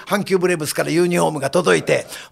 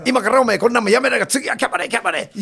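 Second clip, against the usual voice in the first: panicked high shout; 6 semitones higher than usual. Retold or imitated. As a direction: shout while scrambling away. Panicked reporter after attack.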